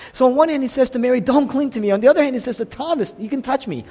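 a preacher quickening to the point; rising excitement. Real, not acted. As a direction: press the contrast with rising energy. Contrasting Mary and Thomas.

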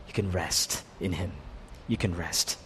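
soft, low, restful; hushed, low-voiced comfort; quiet reassurance. Real, not acted. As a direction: drop right down to reassure. Reassures listeners after the point.

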